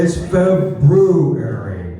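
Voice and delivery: slow, deliberate "Feb-ROO-ary" for February; February with R sounded. Shown as a demonstration. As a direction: stretch February out, sounding the R in "broo". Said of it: "There's no silent R".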